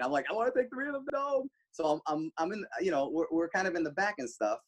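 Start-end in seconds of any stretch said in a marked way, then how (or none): 0.3-1.5 high, soft tearful whine, put on; mock crying. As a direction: imitate yourself bawling over the dogs. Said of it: "Yeah, I'm crying".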